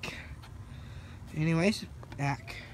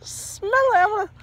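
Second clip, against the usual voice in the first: high strained voice; 14 semitones higher, 6 dB louder than usual. Real, not acted. Gagging after disgusting drink.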